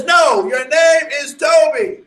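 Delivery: high, loud, slow overseer order; shouted order. Retold or imitated. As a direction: force the name on him. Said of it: "So the conditioner says".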